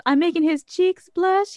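high, put-on teasing voice; Sad Eye Joe. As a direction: imitate the character's playful tease. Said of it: "He goes".

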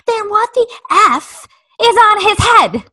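high, loud outraged shout of the swear; imagined furious outburst. Retold or imitated. Mom imagines yelling at the eye doctor, furious high shout. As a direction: blurt it out like you finally snapped. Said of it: "What, Amanda?".

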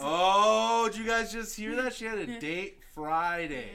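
loud, high outburst of gleeful news; gleeful teasing. Real, not acted. Host teasing co-host about a date to everyone, gleeful. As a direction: crow it loudly, stretching out the end.

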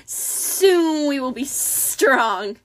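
very slow, drawn-out serpent voice; hissing reptile growl. A narrator's reading. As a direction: savour each word like a snake. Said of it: "a second reptilian voice said".

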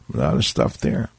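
"A lot of stuff there" dropped low and soft, almost under the breath; 1 muttered aside. Shown as a demonstration. Host echoing his own remark, low and quiet.

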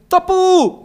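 "Topu" called very high with a long U; long drawn-out U. Retold or imitated. Reading out a listener's greeting, U held long and high. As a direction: stretch the final U of "Topu" in a high hoot. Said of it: "con mucha U".

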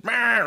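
imitated truck lane warning buzzer; buzzing alarm. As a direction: mimic the lane departure alarm. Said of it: "it just".

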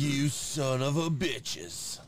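drawn-out, hammed-up cursing; slow mock-furious curse; mock outrage. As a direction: stretch out the insult in play anger. Mock outburst after fake announcement.

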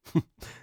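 short warm shopkeeper laugh; jolly chuckle. Acted. Shopkeeper chuckling at Kip. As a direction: chuckle at the sulky boy.

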